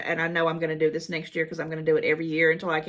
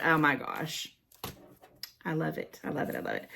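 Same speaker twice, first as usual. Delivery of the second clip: soft, fading murmur, much softer; hushed awe; 3 semitones lower, 7 dB quieter than usual. Real, not acted. Murmuring softly over a homemade card from a fan. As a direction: let it drift away, overcome.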